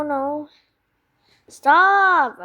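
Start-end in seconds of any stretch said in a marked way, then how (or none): 1.5-2.4 shouting. Real, not acted. Yelling at chicken in game.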